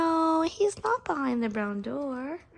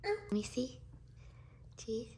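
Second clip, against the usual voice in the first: whispering; 12 dB quieter than usual. Real, not acted. Whisper to toddler.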